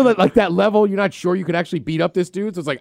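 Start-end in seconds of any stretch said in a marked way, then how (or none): none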